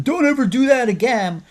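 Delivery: high, loud mock scream at a player; screaming coach. A coach screaming at his quarterback, loud and high. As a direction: bark it like an enraged coach grabbing a face mask. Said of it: "grab the face mask and scream at him".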